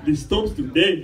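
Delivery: mock-heroic line, heavy Ethiopian accent; stronger Ethiopian accent. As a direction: repeat it firmly, hamming up the Ethiopian accent. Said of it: "I had a stronger accent back then".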